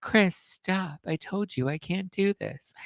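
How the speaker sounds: playfully fending off a kiss with a giggle; giggly coy refusal. Retold or imitated. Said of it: "she's like".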